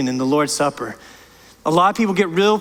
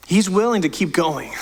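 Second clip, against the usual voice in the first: usual speaking voice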